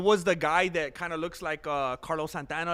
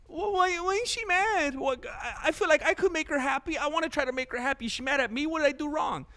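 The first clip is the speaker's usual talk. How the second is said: much higher, pleading mock voice of an eager suitor; mock needy guy; 11 semitones higher than usual. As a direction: play a worried guy begging a scowling girl to smile, hammed up. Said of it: "other kinds of dudes could be like".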